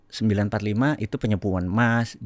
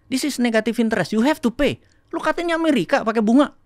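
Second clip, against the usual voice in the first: retold rebuke; 12 semitones higher than usual. Retelling the teller's rebuke.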